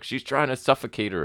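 high-pitched mock commentator line; lightly mimicked wrestling commentary; imitating commentators. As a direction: put on an excited commentator's pitch. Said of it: "so then commentary were like".

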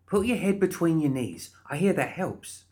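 high-pitched character voice, coaxing; helpful, pushy Molly. Acted. Molly advising a gasping guard, helpfully.

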